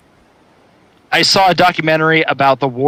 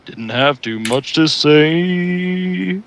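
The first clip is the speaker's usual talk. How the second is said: low slow voice; 4 semitones higher, much slower than usual. Shown as a demonstration. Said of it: "I don't think that was baritone".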